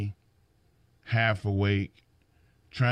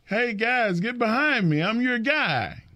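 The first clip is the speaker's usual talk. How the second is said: mock crowd-pleaser; 9 semitones higher than usual. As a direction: ham up a needy wrestler begging for cheers. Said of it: "He wasn't going to the ring going".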